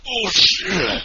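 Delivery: high, loud choked "Oh shit"; mock strained gasp. Cohost mimics his choked gasp for a sound drop. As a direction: mimic the gasp after the chug. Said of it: "that's the best soundbite. You're like".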